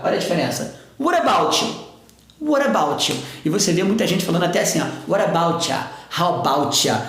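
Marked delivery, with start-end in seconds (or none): 1.0-3.2 "What about" with an R tap, "about you" with CH; tapped T and CH linking. Shown as a demonstration. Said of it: "vai ter o som do C H". 5.1-7.0 "What about ya" linked, ending in a relaxed "cha"; "ya" pulled toward A. Shown as a demonstration. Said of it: "Puxado pro A".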